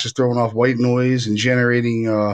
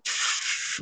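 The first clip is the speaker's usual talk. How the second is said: soft hissed shh of moving cloth; imitated rustling noise; 10 dB quieter than usual. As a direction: make a quiet static-like rustle with the mouth. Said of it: "you hear something go".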